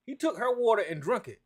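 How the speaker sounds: normal talking voice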